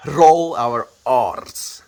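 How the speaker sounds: heavy Afrikaans accent, each R rolled. Shown as a demonstration. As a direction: lay the Afrikaans accent on thick. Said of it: "if I'm completely Afrikaansing it".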